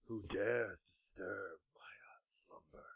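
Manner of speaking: slow whispered voice from the smoke; ancient rasping undead king. Acted. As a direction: breathe it out like a waking ancient evil. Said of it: "and a voice".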